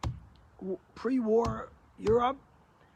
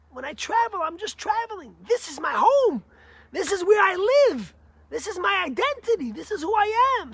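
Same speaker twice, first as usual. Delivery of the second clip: higher and louder, declaring it proudly; emphatic rich man; 6 semitones higher, 8 dB louder than usual. Retold or imitated. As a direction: hammer home "this is my home". Rich man insists on his home.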